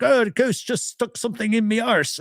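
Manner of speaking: high-pitched imitation of a Scottish accent. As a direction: mimic the ghost hunter in a high Scottish accent. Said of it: "he's Scottish, so he's like".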